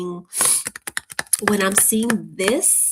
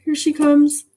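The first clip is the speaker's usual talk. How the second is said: mock panic; 6 semitones higher, 7 dB louder than usual.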